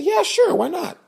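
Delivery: high, breezy and bright; tossing off an easy yes; cheerful promise. Retold or imitated. Man promises the boy a game of catch, breezily high.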